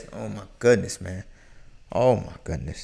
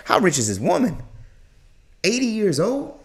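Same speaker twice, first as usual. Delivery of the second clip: usual speaking voice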